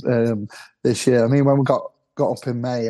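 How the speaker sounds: usual speaking voice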